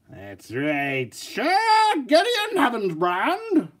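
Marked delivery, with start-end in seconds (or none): 1.1-3.7 high, slow, drawn-out royal herald; grand announcer. Pretend. Vlogger unveiling his knight figure, grand herald announcement. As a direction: announce him like a knight entering court.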